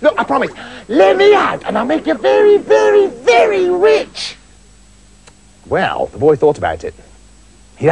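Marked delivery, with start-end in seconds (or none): 0.0-4.4 high, stretched-out pleading; promising spirit. A narrator's reading. Spirit tempting the boy with riches, high pleading. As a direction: draw out the very.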